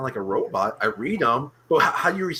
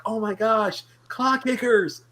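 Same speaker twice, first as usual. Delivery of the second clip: high, thrilled fan squeal; excited; 7 semitones higher, slower than usual. Retold or imitated. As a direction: gush like a starstruck fan. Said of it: "I went crazy".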